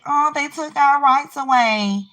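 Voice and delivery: high, slow mocking whine of their complaint. Mocking the people who said their rights were taken, in a high slow whine. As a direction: drag it out like a sneering parody. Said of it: "this is for the people that said".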